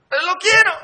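put-on high, loud childish demand; shrill little girl insisting. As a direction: stamp your foot like a spoiled girl.

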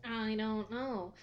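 slow, drawn-out echo; echoing mock mystery. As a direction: copy the co-host's stretched-out wondering. Co-host echoes the drawn-out line.

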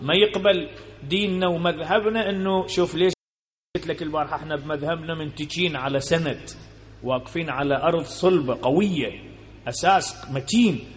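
ordinary talk

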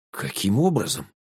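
slightly hoarse, subdued question; a touch of huskiness. A narrator's reading. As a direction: ask it with a dry throat. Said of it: "хрипло спросил я".

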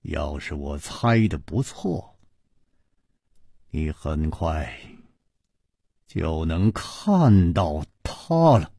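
slow, low, deliberate delivery; word by word. A narrator's reading. Chu promising she will soon see her, word by word. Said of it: "一字字地说".

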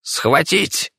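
high, sharp command to seize; emperor's high-pitched command; shouted order. A narrator's reading. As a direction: cry out an urgent order. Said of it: "закричал император".

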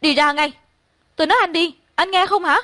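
high-pitched acted yelling; mildly loud, high scream to leave. A narrator's reading. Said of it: "trùm kín đầu la hét".

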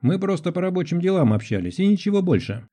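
high, thin voice of a man protesting; thin-voiced man. A narrator's reading. Guilty man to a doubting woman, thin voice. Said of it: "Голос у мужчины был тонкий".

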